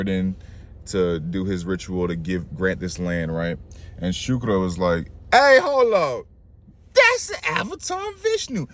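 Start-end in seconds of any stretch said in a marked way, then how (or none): 5.3-8.7 very high-pitched interruption; hammed-up squawk of alarm; alarmed warning. Shukra raises the alarm.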